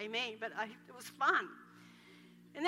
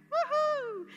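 high, loud "woohoo"; triumphant whoop; 12 semitones higher, 7 dB louder than usual. Real, not acted. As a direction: whoop out in celebration. Preacher cheers mid-scripture.

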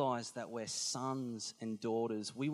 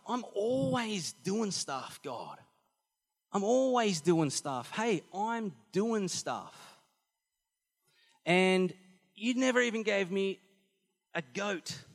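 put-on high, slow, whining older brother; whiny complaint; 6 semitones higher, 4 dB louder, slower than usual.

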